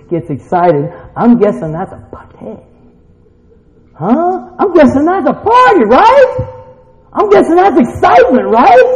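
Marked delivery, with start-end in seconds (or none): 3.9-8.8 high, loud, exuberant preaching; fired-up, pitched-up challenge to the congregation; rousing excitement. Real, not acted. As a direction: whip the crowd up.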